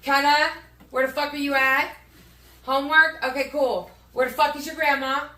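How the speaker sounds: loud home-mom yelling. Retold or imitated. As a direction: bark it out like a fed-up mom. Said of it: "I get home and it's like".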